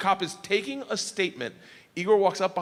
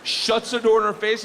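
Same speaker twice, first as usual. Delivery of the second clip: ordinary talk, nothing marked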